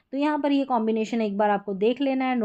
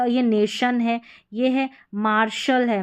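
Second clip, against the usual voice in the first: ordinary delivery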